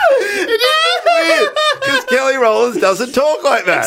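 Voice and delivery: very high, slow squeal through laughter; real laughing fit, words stretched out; helpless laughter. Real, not acted. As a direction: struggle to speak while cracking up. Cracking up at own impression.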